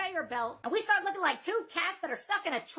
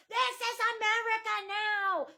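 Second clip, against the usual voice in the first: imitating her outrage; 4 semitones higher, slower than usual. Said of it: "She kept freaking out".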